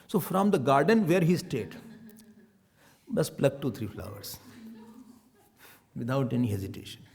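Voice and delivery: normal talking voice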